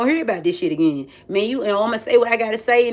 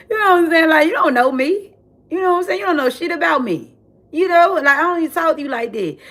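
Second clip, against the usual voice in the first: higher, heated telling-off; raised indignant clapback; 9 semitones higher than usual. Retold or imitated. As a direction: put her in her place. Telling off the bully.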